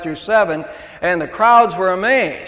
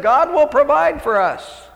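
higher and slow, serenely sure of himself; carefree young man; 10 semitones higher than usual. Retold or imitated. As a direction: spell it out calmly like it settles everything. Young man's carefree reply in joke.

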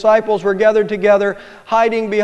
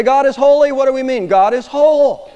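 higher, louder proclamation; loud preaching; 7 semitones higher, 5 dB louder than usual. Acted. Preacher to congregation, loud proclamation. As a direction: proclaim it to the back pew.

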